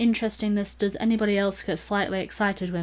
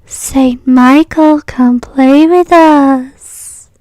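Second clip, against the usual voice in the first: put-on slow, eerie, high ghost child; creepy child voice; 7 semitones higher, 16 dB louder, much slower than usual. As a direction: drag it out like a haunted kid. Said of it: "I've got a special request in the chat".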